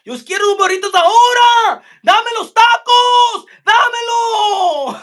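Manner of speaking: 1 hammed-up shouting.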